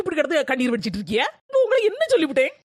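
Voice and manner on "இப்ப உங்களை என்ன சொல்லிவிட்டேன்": higher, louder indignant snap; angry outburst. Acted. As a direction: throw the question back at her in anger. Daughter-in-law snaps during quarrel. (மாமியாரிடம் கோபமாக உரத்த குரலில் கேட்கிறாள்)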